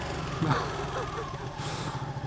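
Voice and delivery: high airy laugh. Real, not acted. Teller amused at own story, airy laugh. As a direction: let a breathy laugh slip out. Laugh after telling crowd reaction.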